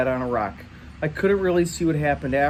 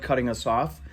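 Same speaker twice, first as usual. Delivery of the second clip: plain reading voice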